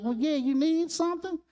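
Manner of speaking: high, easygoing country voice; gentle Gomer. Retold or imitated. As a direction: answer the boy kindly, like Gomer. Gomer answers Opie.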